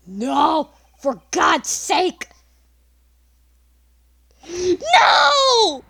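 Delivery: drawn-out, building shouted plea; desperate protest. Acted. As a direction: howl to stop them, ending high. Acted desperate plea against reading.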